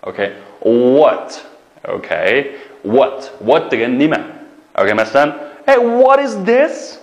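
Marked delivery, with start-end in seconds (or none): 5.7-7.0 high, surprised classroom example; playful surprised question; mock surprise. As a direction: act out finding something odd.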